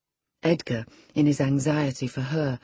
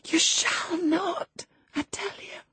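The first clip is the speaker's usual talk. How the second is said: very high, slow, dying into breath; desperate refusal; slower than usual. Acted. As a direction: forbid him to leave, frantically. Dying woman clings to lover.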